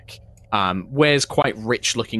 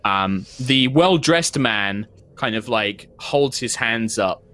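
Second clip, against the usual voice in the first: ordinary talk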